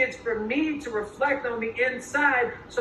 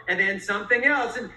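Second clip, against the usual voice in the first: ordinary talk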